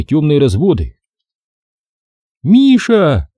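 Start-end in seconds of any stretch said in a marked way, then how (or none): 2.4-3.3 loud high call across the corridor; mother calling her boy, loud and high. A narrator's reading. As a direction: call your son's name as you run in. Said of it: "окликнула его вбежавшая".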